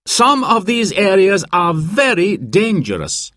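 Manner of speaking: high, projected group-briefing voice; mountain guide presenting. A narrator's reading. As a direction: introduce yourself to guests, pitched well up. Guide Dan briefs the guests.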